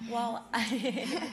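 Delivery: laughing. Real, not acted. Guest answering a nine-year-old's question, laughing. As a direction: start to answer, breaking into laughter. Amused by young child's question.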